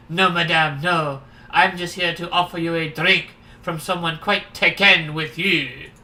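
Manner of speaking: emphatic, hammed-up French accent. Stranger offering a drink from an admirer, French accent. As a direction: play a suave Frenchman with a thick accent. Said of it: "we'll make him French".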